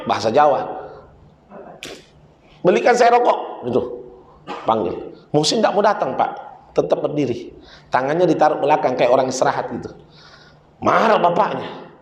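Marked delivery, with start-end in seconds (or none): none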